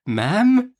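very slow, high, soothing plea; hesitant, gentle coaxing with a stammered start; softest accents. A narrator's reading. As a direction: placate timidly, stretching each word. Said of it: "in his softest accents".

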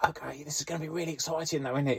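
high, breathy whispered excitement; a keen young colleague whispering, thrilled; excited whisper. Retold or imitated. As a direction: whisper it like an eager rookie. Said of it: "we can even whisper quietly".

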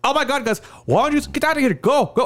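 high-pitched imitation of an upset woman; mock-frantic lady shooing them out; exasperated lady. Lady to two guys fighting in the store, exasperated shooing. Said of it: "And this lady's like".

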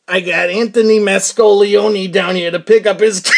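loud mock school-intercom call; lightly voiced guard booming over the school mic; announcing over the microphone. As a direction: broadcast it like a guard on the PA. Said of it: "gets on the microphone to the school".

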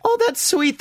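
very high squealing coo; gushing coo. Retold or imitated. The speaker seeing the swapped gear, cooing very high. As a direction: coo it like something adorable. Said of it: "And I went".

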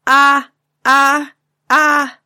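loud open-mouthed ah, ah, ah; loud drawn-out Ah calls; father's loud Ah noise. Acted. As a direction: belt out the Ah like a gaping carp fish. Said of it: "Say it again, please".

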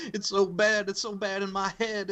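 put-on anguished wail; mock crying. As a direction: imitate a wrestler crying through a promo. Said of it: "like, crying".

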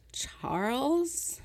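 high, drawn-out rising disbelief; venomous surprise. Acted. Sylvia spotting her ex in the garden, slow high poisonous question. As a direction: let the name ooze out, poisoned. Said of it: "oozed out like a toxic gas".

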